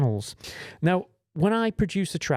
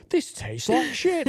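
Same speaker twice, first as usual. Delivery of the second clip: complaining client; 10 semitones higher, slower than usual. Retold or imitated. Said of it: "they come back there".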